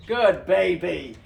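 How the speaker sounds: cooing praise. Real, not acted. Praising the dog.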